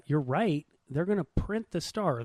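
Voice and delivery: normal talking voice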